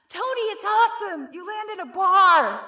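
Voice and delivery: gritado para cima pelo escorregador; calling up. Acted. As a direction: chama alto o amigo lá em cima. Calling up from slide's end.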